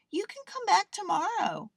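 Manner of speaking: high-pitched mother voice. A narrator's reading. Mother tells her child to come back tomorrow, insisting.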